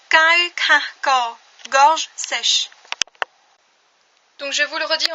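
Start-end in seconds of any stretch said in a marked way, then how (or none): 0.1-1.4 Kai kah ko said very slowly, louder; slow model reading. Shown as a demonstration. Teacher to learners, slowly repeating the sentence.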